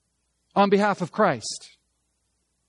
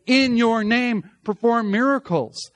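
slow, raised "In your name"; stress on "In your name"; 4 semitones higher, 3 dB louder than usual. Shown as a demonstration. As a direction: punch out "In your name" high and deliberate. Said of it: "The emphasis is upon that idea".

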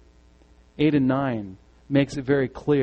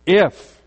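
if punched louder; strong if; 6 dB louder than usual. Shown as a demonstration. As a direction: slam the word if down hard. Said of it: "this is very strong".

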